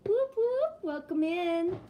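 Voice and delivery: ordinary talk, nothing marked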